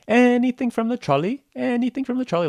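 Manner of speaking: high singsong vendor's call; trolley lady. Acted. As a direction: call out in a high, put-on lady's voice. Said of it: "they have a lady walking through the train".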